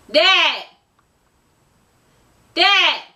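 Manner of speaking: loud, high call to Dad. Real, not acted. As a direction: yell across the house for her father. Calling out to her dad.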